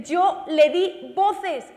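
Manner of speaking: slow booming shout, God calling out. Shown as a demonstration. Preacher voicing God shouting at his people. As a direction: draw out each word as a great shout.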